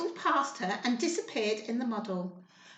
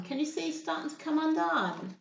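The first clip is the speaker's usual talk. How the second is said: plain reading voice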